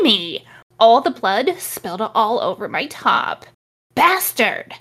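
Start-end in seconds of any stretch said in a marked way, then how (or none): 4.0-4.7 angry shout. Acted. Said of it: "I shouted angrily".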